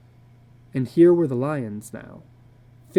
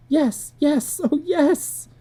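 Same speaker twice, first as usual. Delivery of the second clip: much higher, tumbling eager yeses; cling to the idea desperately; frantic yes; 13 semitones higher, much slower than usual. A narrator's reading. Wife to husband about doing the chores, frantic. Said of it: "a frantic, watery-eyed nodding".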